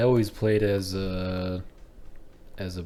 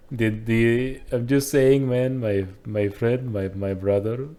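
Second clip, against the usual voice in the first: stammering put-on Indian accent; mock Indian accent; slower than usual. Explaining himself to his Nord brother, mock Indian accent. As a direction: plead your point in mock Indian. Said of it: "He just turns it Indian".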